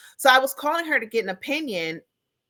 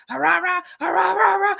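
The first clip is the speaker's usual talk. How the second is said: higher, louder rah-rah imitation of ranting; mock yelling gibberish; 8 semitones higher, 3 dB louder than usual. Imitating a trauma dumper yelling through the phone as mock rah-rah babble. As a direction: parody someone hollering nonstop. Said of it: "they're like".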